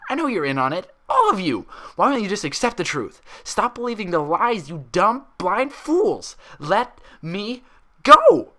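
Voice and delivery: high, loud, rising to a shriek; raving fury. A narrator's reading. Mare in a straitjacket raving at the orderlies, shrieking. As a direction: hurl it at them as they drag you away.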